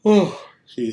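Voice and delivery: words stretched by a yawn. Real, not acted. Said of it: "sorry for yawning".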